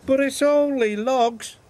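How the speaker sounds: Henry's voice. A narrator's reading. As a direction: protest brightly as the little engine. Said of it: "chuffed Henry".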